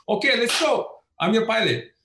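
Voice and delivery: high-pitched, breezy imitation of a pilot; imitated gung-ho pilot. Party-fresh pilot rallying nervous passengers, imitated high and eager. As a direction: play the pilot raring to go.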